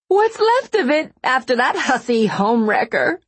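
high, loud, wounded wail; acted shrieky, aggrieved crying voice; tearful outburst. A narrator's reading. Weeping wronged woman lashes out.

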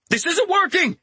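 high, strained shout to a companion. A narrator's reading. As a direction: call it out high over a battle. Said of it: "he shouted to Scion".

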